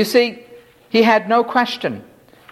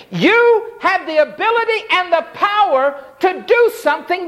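a lightly voiced leper, raised and earnest, begging; pleading leper; 8 semitones higher than usual. Leper kneeling before Jesus, begging to be cleansed, raised earnest plea. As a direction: preach it high as the desperate leper believing. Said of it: "He said".

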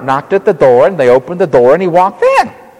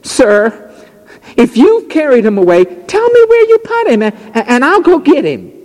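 high, pleading woman's voice, much higher; voiced Mary; 11 semitones higher than usual. Mary begging the gardener, in a high pleading voice.

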